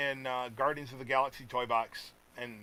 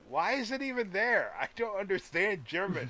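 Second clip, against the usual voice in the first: higher-pitched baffled complaint; mock confusion; 7 semitones higher than usual. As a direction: protest in playful bewilderment. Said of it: "it confuses me".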